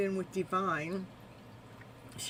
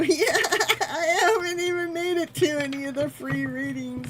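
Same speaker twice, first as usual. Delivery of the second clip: higher and louder, laughing through it; real laughing admission; 8 semitones higher, 7 dB louder than usual. Real, not acted. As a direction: giggle while you confess. Laughing while explaining.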